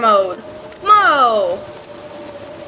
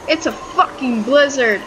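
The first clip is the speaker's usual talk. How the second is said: usual speaking voice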